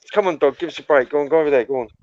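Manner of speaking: ordinary talk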